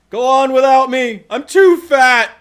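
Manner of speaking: high, slow, loud melodramatic farewell; overacted death scene. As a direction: ham up a dying hero's last words. Hammy sacrifice to zombies.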